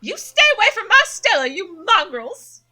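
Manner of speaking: high enraged cry; furious Gretchen. Acted. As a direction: scream at the mongrels to keep off. Voices Gretchen in game.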